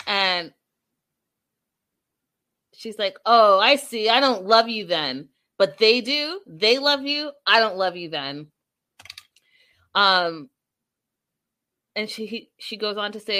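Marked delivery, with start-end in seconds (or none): 3.2-8.5 loud, emphatic huffy retort; mock offended. Retelling a mother's comeback to her son, mock offended. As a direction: imitate an affronted comeback, leaning hard on the first words.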